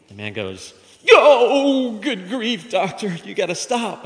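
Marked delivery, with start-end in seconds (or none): 1.1-4.0 high, loud howl easing into a plea; hammed-up pained wail; mock agony. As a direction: yell in comic pain, then beg. Patient touches knee, howls.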